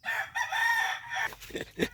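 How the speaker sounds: gargling. Real, not acted.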